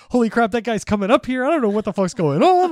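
high, loud mock panic; panicked babble, acted out for the story; retold panic. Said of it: "I'm just, like, panicking".

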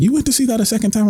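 high, fast disbelieving question; incredulous. Retold or imitated. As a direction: act shocked someone went twice. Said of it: "they were like".

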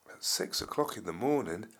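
ordinary delivery

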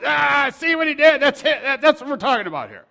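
high, loud acted cry of accusation; outraged outburst. Priests tearing their robes at Jesus's answer, outraged shouting.

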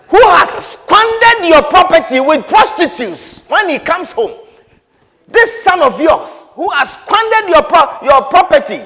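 loud, high, indignant accusation; mock outrage at the brother's sins; accusing son. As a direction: spit out the charge against his brother. Elder son accusing the prodigal.

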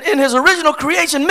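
ordinary talk

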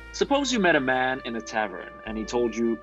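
normal talking voice

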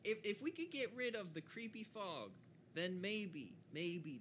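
very high, squealing exasperation; mock-panicked rant. Player protests the returning creepy fog, high mock-panicked rant. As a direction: pitch it way up, hammed-up hysterics.

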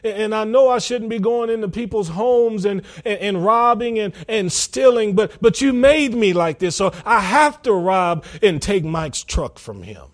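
high, loud imitation of whining excuses; hammed-up pleading, no real tears; mock tearful plea. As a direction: protest to God as a put-upon sinner. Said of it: "you can cry and say".

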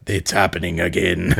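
low, grave and ominous; Reinhardt voice. Retold or imitated. Reinhardt warning Torb of trouble, low and grave. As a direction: drop it low and heavy. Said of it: "And Reinhardt's like".

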